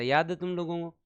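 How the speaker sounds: ordinary talk, nothing marked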